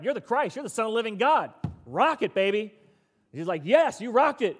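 high, loud hyped-up cheer; excited Peter. Acted. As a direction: shout it like a sports win. Peter and Jesus hyped exchange.

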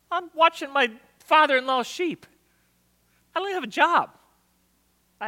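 high, whining Moses; protesting Moses. Acted. Moses answering God's call, high and protesting. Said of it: "Moses says back".

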